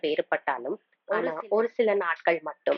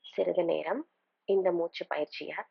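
unhurried meditation guide, long pauses between phrases; calm, spaced-out instructions with room to breathe; guided meditation. Acted. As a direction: lead them gently through breathing and imagery.

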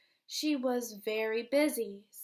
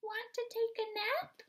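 very high sleepy cat voice; 9 semitones higher than usual. Acted. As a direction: ask like a lazy kitty. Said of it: "cried the cat".

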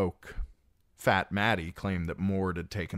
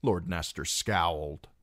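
ordinary delivery, nothing marked

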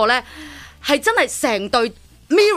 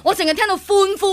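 excited cheer; 7 semitones higher than usual. Retold or imitated. Retelling crowd cheering.

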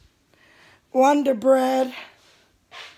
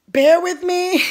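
"Bear" said higher and louder, begging patience; higher, louder plea; 6 semitones higher, 7 dB louder than usual. Real, not acted. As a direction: plead "Bear with me" up high and loud, then laugh. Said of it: "so don't trip".

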